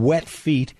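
usual speaking voice

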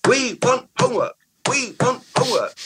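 put-on high chanting childish shout; kids shouting. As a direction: chant it like seven-year-olds demanding. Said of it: "shouting, going".

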